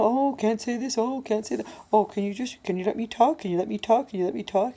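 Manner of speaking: mock his repeated pleas to talk; Paul. Imitating an attention-seeking man at day program, mocking. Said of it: "I don't wanna hear Paul sit there and tell me".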